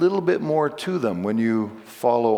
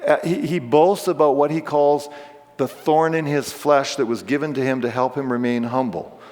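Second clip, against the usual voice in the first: ordinary talk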